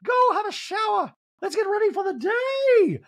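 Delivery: very high, drawn-out sing-out; slow theatrical high-pitched send-off; Dorit. Retold or imitated. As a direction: order them off in an exaggerated high voice. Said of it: "And Dorit's like".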